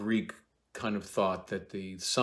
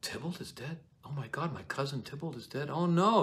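high but hushed, stunned; shocked Juliet; 6 semitones higher than usual. Acted. Juliet learning of her cousin's death, hushed shock. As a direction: breathe it out in dismay. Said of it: "Juliet's like".

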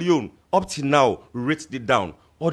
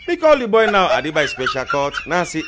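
ordinary talk

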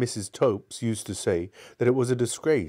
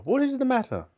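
alarmed; faster than usual. Acted. Alarmed concern at crying.

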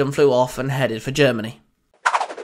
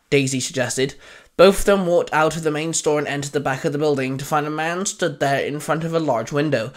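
ordinary delivery

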